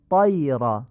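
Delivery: 'طیر' as 'toyr' with dark back vowel; full-mouthed short vowel in 'طیر'; heavy Arabic fatha. Shown as a demonstration. Teacher giving an example, heavy 'طیر'.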